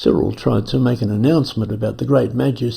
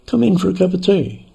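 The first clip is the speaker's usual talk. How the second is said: put-on higher, genteel British accent inviting to tea; 8 semitones higher, faster than usual. Lama inviting weary surveyors in, mild British accent. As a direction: offer the tea like a chirpy English host. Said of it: "spoke with a perfect British accent".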